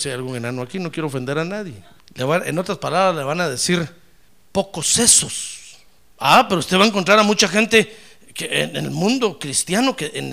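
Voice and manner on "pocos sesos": whispered jab. Acted. Mock name, slow.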